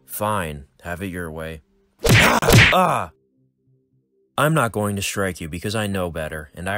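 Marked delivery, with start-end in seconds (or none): 2.0-2.4 sharp loud scream of pain. Acted. Sudden pain cry. 2.7-3.1 second loud, higher cry of pain. Acted.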